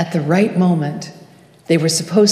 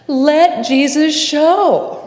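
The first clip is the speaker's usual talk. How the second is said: put-on high, drawn-out cry of a frustrated small girl; shrill, frustrated cry; 8 semitones higher, 4 dB louder, slower than usual. A narrator's reading. Small girl with her view blocked at the pageant, shrill frustrated cry. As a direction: cry it out shrilly, irritated and sad, stretching the words. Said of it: "crying out in a shrill voice, with irritation and frustration and enormous sadness".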